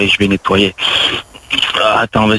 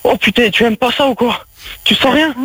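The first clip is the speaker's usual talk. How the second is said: hammed-up climax; 13 semitones higher than usual. Improvised sex scene, mock climax.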